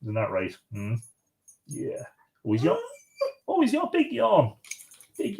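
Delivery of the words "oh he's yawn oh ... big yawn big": pet talk. Real, not acted. Owner to his yawning dog, high cooing pet talk. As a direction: coo at a sleepy pet.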